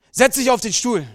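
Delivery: loud, sharp command; angry father commanding; barked order. Acted. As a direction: order the boy over harshly. Drunk father ordering his son.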